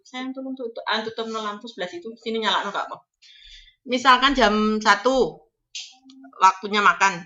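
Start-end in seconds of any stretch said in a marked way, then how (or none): 0.0-3.1 pelan, agak cepat menyuruh; tiruan majikan. Retold or imitated. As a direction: majikan menyuruh dengan santai. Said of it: "ini contoh ya". (majikan menyuruh pekerja mematikan lampu, pelan dan santai)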